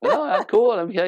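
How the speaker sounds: high-pitched, breezy refusal; oblivious husband. Retold or imitated. Man brushes off wife's hint, oblivious. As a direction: wave off the concern cheerfully, missing the hint.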